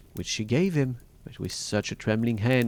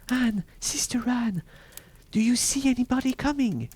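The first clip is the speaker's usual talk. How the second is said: high, slightly restrained anxious call; soft cry; 3 dB louder than usual. A narrator's reading. Wife secretly calling to Sister Anne, restrained high cry. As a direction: call up anxiously without full volume. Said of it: "cried out very softly".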